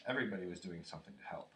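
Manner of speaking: plain reading voice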